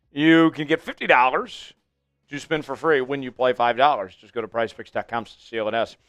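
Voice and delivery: brisk ad-read voice for Prize Picks; sponsor read. Acted. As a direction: sell it like a host reading an ad.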